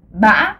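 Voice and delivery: ba broken in the throat, then rising; glottal rising ba. Shown as a demonstration. Teacher shows ba with the throat, glottalised rise. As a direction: catch ba in the glottis and let it climb. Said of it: "j'utilise ma glotte pour prononcer".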